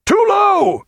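high piercing shout; cry out. A narrator's reading. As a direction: cry it over the guns. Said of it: "he cried".

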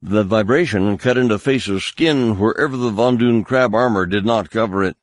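plain reading voice

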